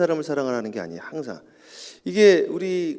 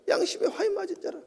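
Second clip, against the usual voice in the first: high but softer repeat, 화인 still stressed; quieter echo; 5 dB quieter than usual. Acted. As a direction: let 화인 land again, softer.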